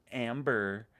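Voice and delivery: stretched Amber with a heavy American r; exaggerated American accent. Retelling the girl's take on Americans, exaggerated American accent. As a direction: drag out the American r in mockery. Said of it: "But she goes".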